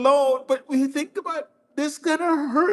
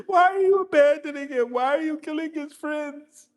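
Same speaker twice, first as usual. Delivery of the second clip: crying plea; 4 semitones higher than usual. Real, not acted. Preacher weeping, pleading with attackers.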